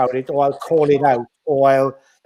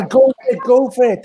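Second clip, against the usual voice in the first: higher, louder cheerleader encouragement; cheering on; 7 semitones higher, 4 dB louder than usual. Retold or imitated. Successful artists cheering on a newcomer, excited cheer. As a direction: shout them on like a fan in the stands. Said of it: "They're going".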